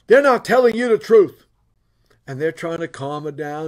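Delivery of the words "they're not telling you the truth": retold angry outburst, loud and higher; mad wife. Wife telling husband these guys lie, mad and loud. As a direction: voice a wife loudly warning her husband. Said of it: "and she's mad".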